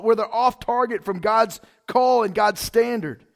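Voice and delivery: loud, driving sermon delivery; full-force preaching. Acted. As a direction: preach it hard to the congregation.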